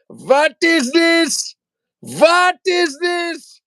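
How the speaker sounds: very high, loud, drawn-out outcry; mock shock. Host discovers the horse's anatomy, mock shock. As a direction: squeal each word slowly in pretend astonishment.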